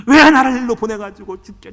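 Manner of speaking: mock his wife's loud complaint; complaining wife. Pastor imitating wife griping about seminary, loud whine. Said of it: "죽을 싸 그러는 거예요".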